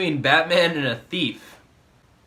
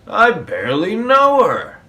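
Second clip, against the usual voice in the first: loud punchline; 6 semitones higher, 10 dB louder than usual. Retold or imitated. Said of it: "The guy goes".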